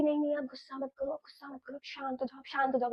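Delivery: hushed, soothing plea to calm him; mock-soothing a angry boyfriend softly; softly begging him to calm down; soft pleading. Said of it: "ये ऐसे बोल रही है कि".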